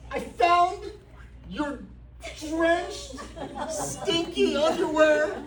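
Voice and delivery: over-the-top jealous wife confronting her husband; mock jealous wife. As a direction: play the suspicious wife demanding answers. Wife accusing on phone.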